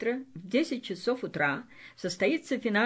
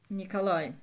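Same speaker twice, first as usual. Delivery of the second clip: usual speaking voice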